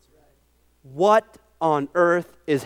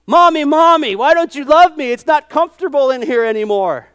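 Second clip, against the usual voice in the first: higher, louder whiny little eaglet; baby eaglet voice; 9 semitones higher, 10 dB louder than usual. Retold or imitated. Preacher voicing a baby eagle in a prickly nest, whining. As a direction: complain like an uncomfortable chick. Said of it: "And Steve Mianje goes".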